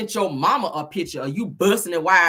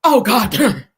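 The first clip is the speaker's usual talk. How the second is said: louder, slow mock-genteel panic; 8 dB louder, slower than usual.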